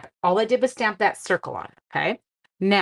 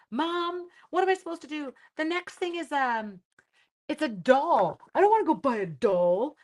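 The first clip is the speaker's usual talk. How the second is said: much higher, whiny mock-teen voice; whiny teen son; 8 semitones higher than usual. Son complaining to mom about school doll, whiny and high. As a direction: play the put-upon son complaining. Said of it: "I got the hilarious".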